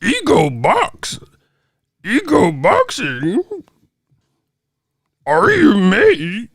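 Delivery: high, drawn-out mock creature voice; Yoda. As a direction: read it slowly like a baffled alien sage. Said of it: "Dyslexic Yoda sounded that".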